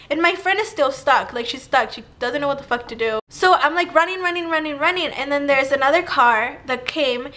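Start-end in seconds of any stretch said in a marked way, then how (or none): none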